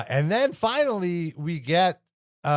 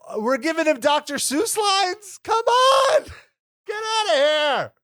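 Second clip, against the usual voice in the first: high, loud Seinfeld-style exasperation; Jerry Seinfeld voice; 11 semitones higher, 3 dB louder than usual. Acted. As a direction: whine it like a sitcom comic. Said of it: "I sound like Jerry Seinfeld".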